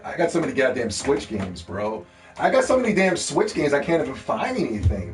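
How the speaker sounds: ordinary talk, nothing marked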